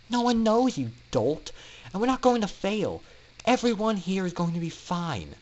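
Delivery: high, brisk friendly scolding; reassuring Scootaloo. Acted. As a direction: tease him then firmly reassure.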